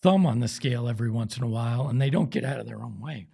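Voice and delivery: ordinary delivery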